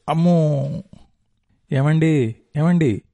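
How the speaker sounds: alarmed calling out. A narrator's reading. Wife shaking dreaming husband awake.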